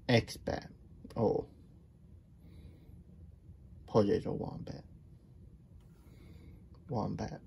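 whisper. Pretend. Whispered word game.

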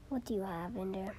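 fast soft whisper. Pretend. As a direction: whisper it quickly. Whispered question.

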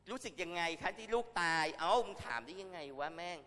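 indignant rant. Real, not acted. Quoting then cursing the reporter.

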